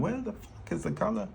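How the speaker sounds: high-pitched frantic self-quote; mock frantic search. Artist mimics herself hunting for a colour, high and frantic. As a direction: squeal it like a panicked search. Acting out own hunt for colour.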